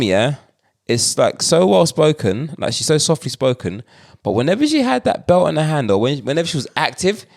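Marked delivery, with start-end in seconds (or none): 4.2-7.3 put-on African accent. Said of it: "why am I doing African accent".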